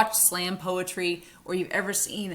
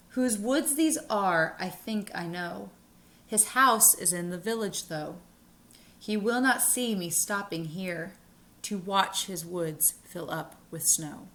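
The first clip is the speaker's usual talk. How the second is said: slow poetry reading. Shown as a demonstration. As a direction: read the verse aloud slowly, unhurried. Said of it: "I'm gonna read it slowly".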